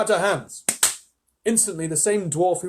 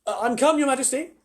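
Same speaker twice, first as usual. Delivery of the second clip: put-on nervous boy; 11 semitones higher, 6 dB louder than usual. Edmund nervously greets the Witch.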